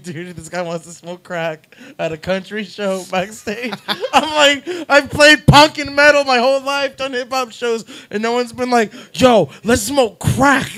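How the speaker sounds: high loud laughing disbelief; can't believe it, laughing loud. Retold or imitated. Singer telling friends, high loud laughing disbelief.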